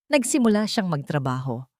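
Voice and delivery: ordinary delivery, nothing marked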